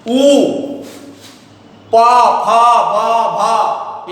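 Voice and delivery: प फ ब भ said alone from closed lips; lip letter sounds. Shown as a demonstration. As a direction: sound out प, फ, ब, भ for the class. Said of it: "इनका उच्चारण स्थान ओष्ठ है".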